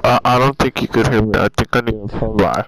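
loud, very low mock-serious voice; put-on deep voice. Gamer telling his friend off for yelling, in a booming low voice.

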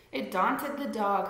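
ordinary delivery, nothing marked

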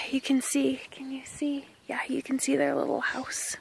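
soft unvoiced whisper; hushed whisper pointing out the house. Real, not acted. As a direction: whisper so the beavers aren't disturbed. Hushed so as not to disturb.

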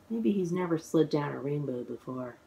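usual speaking voice

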